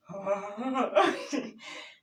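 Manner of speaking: loud laugh; laughter. Real, not acted. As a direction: burst out laughing. Laughs at dad's anger.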